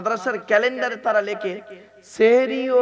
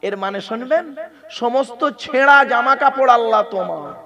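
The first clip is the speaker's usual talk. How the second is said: ordinary talk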